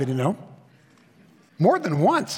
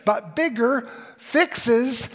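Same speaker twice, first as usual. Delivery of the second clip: slow emphatic; 3 semitones higher, much slower than usual. Shown as a demonstration. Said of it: "slow emphasis".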